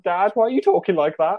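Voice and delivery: high-pitched puzzled question, rising; bewildered child. Retold or imitated. Child asking dad about his accent, bewildered. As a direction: question a parent in mock bewilderment, pitch lifted.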